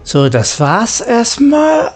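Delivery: ordinary talk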